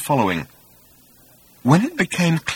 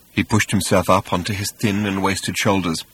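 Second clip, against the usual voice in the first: ordinary delivery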